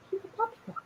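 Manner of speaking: high cooing pet voice. Real, not acted. Owner cooing at her puppy. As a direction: coo softly.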